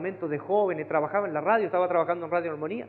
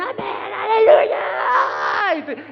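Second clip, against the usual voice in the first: much higher, loud stretched cry; church shouting amen; 10 dB louder, much slower than usual. Retold or imitated. Preacher mimics the church cheering, loud and drawn out. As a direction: cry out like a whole congregation. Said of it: "y toda la iglesia".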